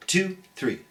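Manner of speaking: ordinary delivery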